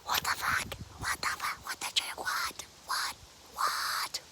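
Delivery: repeated voiceless whispers of disbelief; aghast whisper. Real, not acted. Storyteller reacting to her bitten friend laughing, in a stunned whisper.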